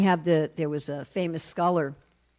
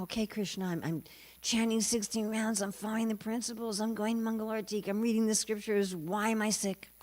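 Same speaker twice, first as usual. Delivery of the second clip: higher-pitched, aggrieved list of good deeds; mock complaint; 5 semitones higher than usual. Sick devotee complaining to Krishna, higher and put-upon. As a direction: imitate a whining plea for fairness.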